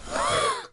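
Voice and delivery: sharp high gasp of horror; high shocked intake of breath. Acted. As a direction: gasp at the rude outburst. Family gasps at the outburst.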